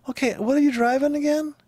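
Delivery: high-pitched put-on question; mock inquiry. Asking a driver about lookalike cars, mock inquiry.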